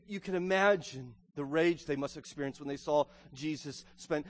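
ordinary talk

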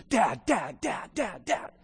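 put-on insistent child calling over and over; kid calling dad. Child interrupting a conversation, repeatedly calling for dad. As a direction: tug at dad's sleeve with your voice.

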